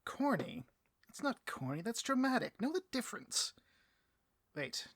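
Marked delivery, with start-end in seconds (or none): 0.0-3.5 high, offended retort; indignant. Acted. Boss defending his speech to his henchman, high and indignant. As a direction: bristle at the critique.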